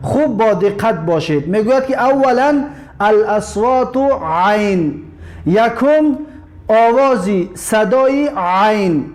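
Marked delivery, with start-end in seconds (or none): 2.0-9.0 slow explaining. Shown as a demonstration. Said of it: "عین را تلفظ کردنش رو چقدر متوجه باشید".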